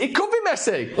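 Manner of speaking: high-pitched, hyped-up discovery; excited realisation. Pretend. Host hypes a wild Messi guess, excited. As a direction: leap up in pitch with mock amazement.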